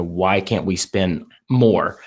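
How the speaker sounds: normal talking voice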